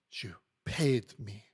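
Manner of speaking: slow, hissed, whispery contempt; fierce disdain. A narrator's reading. Maid repeats the lawyer's words, fiercely disdainful. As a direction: throw it back slowly with scorn. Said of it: "she repeats with fierce disdain".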